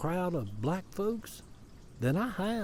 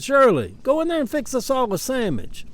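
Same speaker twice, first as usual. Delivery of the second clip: loud, high-pitched country uncle; mimicked uncle hollering to his wife; imitated Southern uncle; 10 semitones higher, 7 dB louder than usual. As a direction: call out an order like the uncle, "sandwich" as sammidge. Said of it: "my Uncle Aaron said sandwich".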